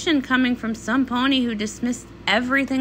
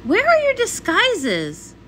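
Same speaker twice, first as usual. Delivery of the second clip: surprised; 8 semitones higher, slower than usual. Acted. Shocked at friends' plain look.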